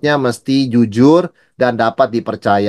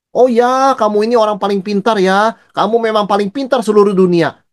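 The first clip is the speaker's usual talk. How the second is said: sarcastic praise; 7 semitones higher than usual. Retold or imitated. Preacher demonstrates sarcasm to his church with high mock praise. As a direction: pretend to compliment someone while mocking them. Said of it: "itu menyindir".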